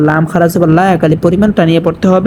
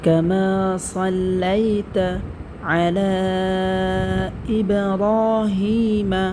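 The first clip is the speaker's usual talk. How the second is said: very soft, slow chanted durood; Quranic recitation; 12 dB quieter, much slower than usual. Shown as a demonstration. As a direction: recite the whole durood softly and slowly. (নরম স্বরে ধীরে দরুদ তেলাওয়াত)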